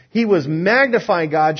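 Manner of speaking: normal talking voice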